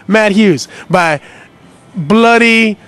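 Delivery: loud, slow fight hype; hyped-up. Acted.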